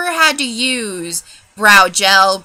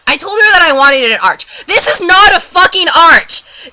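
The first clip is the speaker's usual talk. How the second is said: loud, rising into a high shout; angry complaint; 5 semitones higher, 7 dB louder than usual. Real, not acted. Client ranting about the arch she asked for, angrily. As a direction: build to a furious protest.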